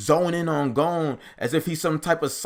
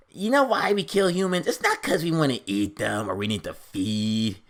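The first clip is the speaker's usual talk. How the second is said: mock villain. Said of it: "the armadillo guy goes".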